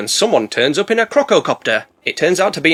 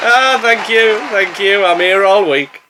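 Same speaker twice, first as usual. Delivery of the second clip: mock stand-up comic; 4 semitones higher, 4 dB louder, slower than usual. Mock comedian thanking an audience.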